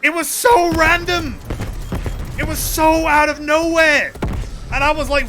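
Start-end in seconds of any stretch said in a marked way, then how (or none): 0.0-4.1 loud, high, slow and drawn-out; exclaiming, leaning hard on each "so"; astonished emphasis. Real, not acted. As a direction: stretch it out in disbelief. Stressing how random it was.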